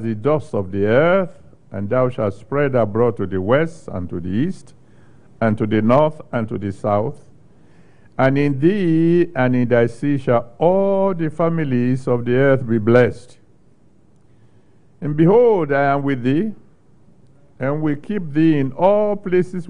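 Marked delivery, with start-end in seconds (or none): none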